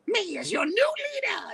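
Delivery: high, screechy scheming villain; Starscream. Acted. Actor voicing Starscream claiming leadership, high screechy impression. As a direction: shriek it like a power-hungry second in command. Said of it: "Starscream constantly going".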